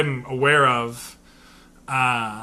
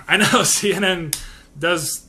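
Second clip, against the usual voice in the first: ordinary talk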